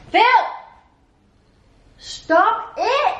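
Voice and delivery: sharp, high shout at the dog; scolding shout. Real, not acted. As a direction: yell at him to stop.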